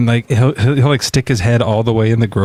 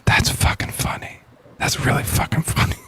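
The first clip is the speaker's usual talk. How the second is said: hushed, voiceless aside; whispered; 4 semitones higher, 6 dB quieter than usual. Retold or imitated. Recalling a young self's reaction to a joke, whispered. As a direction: whisper it like a kid secretly amused.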